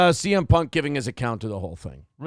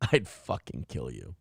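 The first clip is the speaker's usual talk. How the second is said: much lower and softer, cold and calm; low quiet threat; 11 dB quieter than usual. Retold or imitated. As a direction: say it low and flat, almost under the breath.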